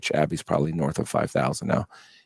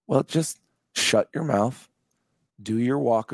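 stern scolding; 4 semitones higher, slower than usual. Retold or imitated. Buddy scolds him.

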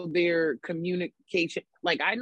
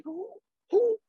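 much higher, put-on indignant squeal; annoyed. Answering an unwanted app call, acted-out annoyance. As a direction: act out high-pitched annoyed disbelief. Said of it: "I get annoyed when people call me".

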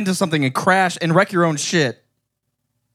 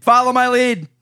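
high mock command; 8 semitones higher than usual. Shown as a demonstration. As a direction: sing out "Follow my lead" high. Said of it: "Don't follow my lead".

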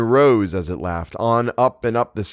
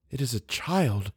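soft, hushed realization; disbelief; 2 semitones higher, 7 dB quieter than usual. A narrator's reading. Eleanor hearing a cry in the night, hushed disbelief. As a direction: think it aloud softly, not believing it. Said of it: "she thought with disbelief".

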